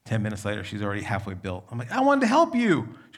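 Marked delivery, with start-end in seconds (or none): 1.9-2.9 much higher, louder whiny plea; hurt protest. Retold or imitated. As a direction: complain like a let-down dad. Disappointed dad to daughter.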